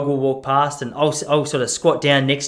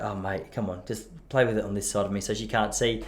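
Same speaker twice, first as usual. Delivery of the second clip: much softer and lower, kept under the radar; sneaky hush; 6 semitones lower, 10 dB quieter than usual. Retold or imitated. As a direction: hush it so the lady won't hear. Hiding rubbish from old lady.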